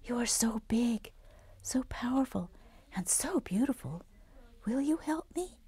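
high, hushed whisper full of wonder; awed whisper. Acted. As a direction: breathe the words gently to the dragon. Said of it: "whispered Atom".

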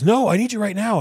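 high, loud, urgent plea; urgent call. Retold or imitated. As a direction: demand help right now. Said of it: "she goes".

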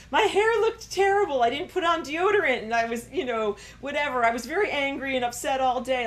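high, loud flustered excuse; embarrassed excuse. Pretend. Person excusing their worst day, high and loud. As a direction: rush to excuse yourself.

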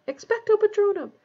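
high, loud strained cry; desperate incantation. A narrator's reading. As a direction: cry out the spell while struggling. Harry fighting on, casting the spell.

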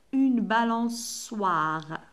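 une balançoire drawn out; slow model word. Shown as a demonstration. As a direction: stretch une balançoire syllable by syllable. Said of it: "la lettre C". (une balançoire ببطء)

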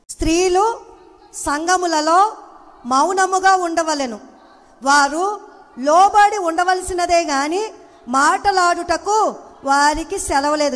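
loud, slow, solemn scripture reading; high, weighty Bible reading; scripture read aloud. Acted. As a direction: declaim the verse slowly.

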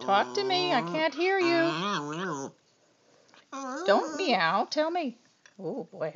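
ordinary talk, nothing marked

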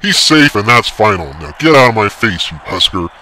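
loud, final, in his face; angry yell. A narrator's reading. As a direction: shut the argument down hard. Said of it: "yelled the umpire".

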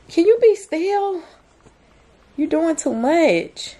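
high, exasperated plea to keep still; exasperated scolding. Real, not acted. Mom telling her wriggling toddler to be still, high and exasperated.

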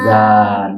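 জাল with tongue between the teeth, long aa; pronunciation demo. Shown as a demonstration. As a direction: model the dental fricative in জাল, then stretch the vowel. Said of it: "জিহ্বা রেখে তারপর উচ্চারণ".

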